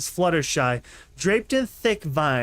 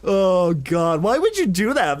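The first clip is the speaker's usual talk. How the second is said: surprised; 3 semitones higher, 3 dB louder than usual. Acted. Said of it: "Velvet Remedy asked".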